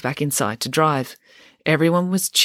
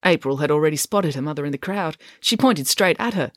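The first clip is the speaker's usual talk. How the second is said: usual speaking voice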